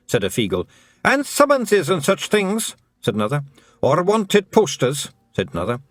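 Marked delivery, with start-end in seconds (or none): 1.0-2.8 high Scots little man, cheerfully frank; a wee Scottish thief owning up; Feegle voice. A narrator's reading. Feegle admits stealing to Tiffany, high and cheerful. 3.8-5.1 high Scots little man, cheerfully frank; a wee Scottish thief owning up; Feegle voice. A narrator's reading. Feegle admits stealing to Tiffany, high and cheerful.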